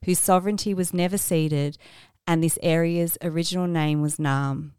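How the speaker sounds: solemn formal address to listeners; formal acknowledgement. Acted. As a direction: read it like an official statement. Formal acknowledgement of country read.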